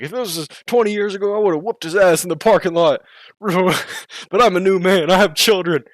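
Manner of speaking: mock angry boast. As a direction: brag like an old brawler holding back.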